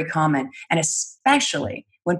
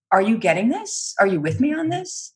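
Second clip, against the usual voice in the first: questioning lilt, "this" lifting much higher at the end; seeking approval; 5 semitones higher than usual. Shown as a demonstration. Coach voicing the uptalk's hidden question, rising. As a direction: sound like you need them to agree. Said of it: "as if the inflection itself is saying".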